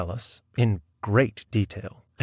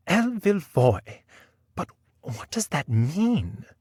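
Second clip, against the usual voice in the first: high-pitched, drawn-out query; puzzled lady novelist; 7 semitones higher, slower than usual. Acted. Novelist asks after sipping the chocolate, high puzzled voice. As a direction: ask it slowly in a high lady's voice.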